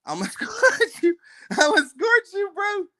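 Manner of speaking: high, laughing mock threat; laughing high taunt. Real, not acted. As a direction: giggle the threat.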